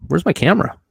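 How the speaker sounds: high puzzled question; searching, confused and high; baffled. Retold or imitated. Camera missing from the tree.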